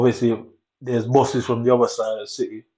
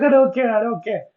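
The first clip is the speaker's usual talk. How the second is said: high, loud careless voice; mocking girl; 11 semitones higher, 5 dB louder than usual. Mocking the girl who won't stop talking, loud and high. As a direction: toss it off like she doesn't care. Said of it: "She's like".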